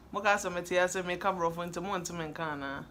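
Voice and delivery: ordinary delivery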